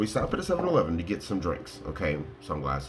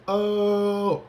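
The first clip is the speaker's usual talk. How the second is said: high, loud, held-out "Oh"; Terry Crews; 12 semitones higher, 5 dB louder than usual. Acted. Shouting like an Old Spice ad, exuberant impression. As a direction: belt out a long commercial-style "Oh". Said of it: "like Terry Crews".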